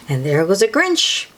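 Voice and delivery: ordinary delivery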